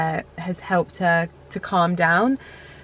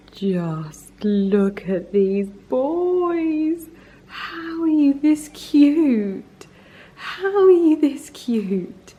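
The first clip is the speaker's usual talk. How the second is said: high, very slow doting coo; high cooing over cute cats; 5 semitones higher, much slower than usual. Real, not acted.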